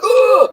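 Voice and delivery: high loud yelp of pain; a retold sudden yelp of pain. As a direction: cry out as the chest stabs. Pain on deep breath, retold.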